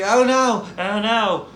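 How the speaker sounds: high, loud mock panic. The other girl running after her, hammed-up high panic. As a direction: ham up the girl's frightened cry.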